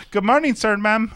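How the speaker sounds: high-pitched mimicked staff greeting; hammed-up greeter voice; imitated hotel staff. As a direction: imitate hotel workers' welcome, pitched well up. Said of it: "And so then they said".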